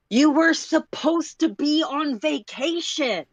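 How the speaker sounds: high, slow yelling call after someone; shout. Acted. Sulik chasing the runner, shouting high and slow. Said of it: "Sulik shouts after you".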